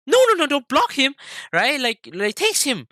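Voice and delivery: bipolar mom. Retold or imitated. Mom reversing her order to block him, high and frantic. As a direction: imitate a mom squawking contradictory orders. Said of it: "kept being bipolar and was like".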